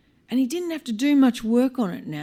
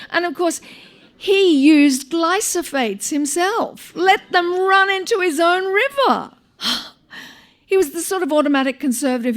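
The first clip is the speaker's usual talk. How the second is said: building to a loud, much higher indignant outburst; outraged rant; 9 semitones higher, 5 dB louder than usual. A narrator's reading. Her outrage at his farming.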